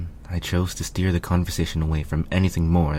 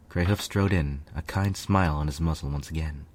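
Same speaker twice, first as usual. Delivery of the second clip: usual speaking voice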